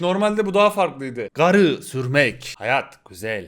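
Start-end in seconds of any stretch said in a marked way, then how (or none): 1.3-2.5 lower, slow drawn-out voice; imitating the clip voice. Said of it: "diksiyonu bir farklıymış". 2.6-3.5 imitating the clip voice. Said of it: "diksiyonu bir farklıymış".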